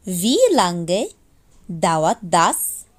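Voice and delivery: ordinary delivery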